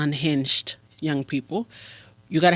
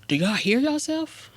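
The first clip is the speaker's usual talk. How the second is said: incredulous question, pitched up; raised-pitch reaction to bad rapping; acted disbelief; 6 semitones higher than usual. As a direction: ask it as if baffled by what you heard. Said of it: "and I'm like".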